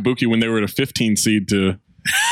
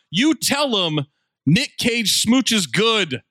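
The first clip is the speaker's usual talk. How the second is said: higher-pitched mock proclamation, drawn out at the end; mock retort; 7 semitones higher, much slower than usual. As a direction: declare it like a put-on comeback. Acting out mock retort to review.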